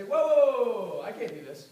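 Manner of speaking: loud high whoa, whoa, whoa, then a laugh; alarmed backing off. Retold or imitated. Recruiter acting out a panicked prospect, loud and laughing. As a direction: play a startled prospect pulling back. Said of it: "a lot of people are gonna say".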